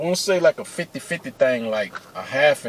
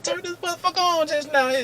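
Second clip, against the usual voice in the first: high-pitched, disbelieving manager voice; mock the manager in a much higher voice; incredulous manager; 4 dB quieter than usual. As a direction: squeak it out as a baffled boss. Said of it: "He's like".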